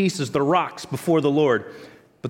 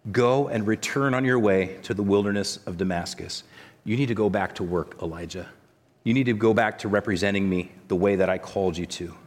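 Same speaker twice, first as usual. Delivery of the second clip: gentle command; 7 semitones lower, 3 dB quieter than usual. Acted. God gently sends Elijah back.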